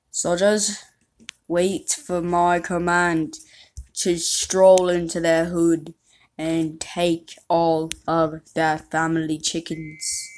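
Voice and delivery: slow mock British orders; British commander. Commanding British troops in a slow mock British accent. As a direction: lay out the orders like a British officer. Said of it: "talking in a British voice".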